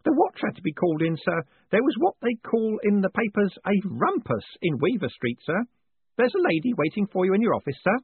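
put-on high, fussy old postman, proud of his takings; an eager old clerk showing off coins; old man proudly reporting to his boss; proud old Grote. A narrator's reading.

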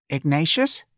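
high, anxious trembling question. A narrator's reading. Mother questioning her son, trembling voice. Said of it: "asked in a trembling voice".

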